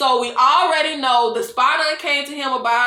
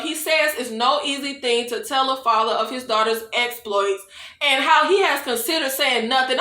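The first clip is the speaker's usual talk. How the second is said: ordinary talk, nothing marked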